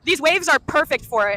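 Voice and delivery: loud, slow, thrilled; excited. Real, not acted. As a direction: call it out with excitement. Thrilled at the waves.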